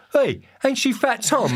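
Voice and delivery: slow, high-pitched call of recognition; imitated geezer, pitched high and drawn out; stranger recognising someone. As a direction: call out as you spot a known face. Voices menacing stranger recognising him.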